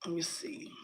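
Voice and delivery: breathy whisper to herself; whispered aside. Real, not acted. Checking her screen on stream, a whisper to herself. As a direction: drop to a whisper, thinking aloud. Muttering to herself while pasting.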